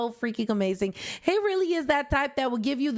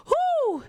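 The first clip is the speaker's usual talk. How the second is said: high flustered whoo. Real, not acted. Fanning herself after fanfic.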